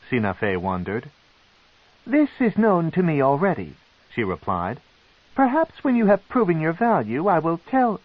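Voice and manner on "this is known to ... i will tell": high, intrigued noblewoman; a proud matron, curious and cold; matron's voice. A narrator's reading. The matron questions the captive, high and probing.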